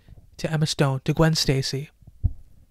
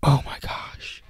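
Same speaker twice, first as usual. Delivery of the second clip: awed whisper; 3 semitones lower, 11 dB quieter, slower than usual. Retold or imitated. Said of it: "And you're just like".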